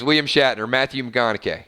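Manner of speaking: ordinary talk, nothing marked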